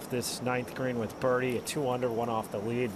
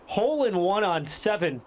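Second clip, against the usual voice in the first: excited; 6 semitones higher, 4 dB louder than usual. Real, not acted. Recapping the ace.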